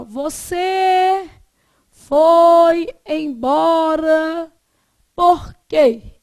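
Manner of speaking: slow, loud "foi embora", then a strong "por quê"; loud, drawn-out question with a stressed "quê"; stressed final quê. Shown as a demonstration. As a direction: drag it out and land hard on "quê". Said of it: "quando eu falo assim".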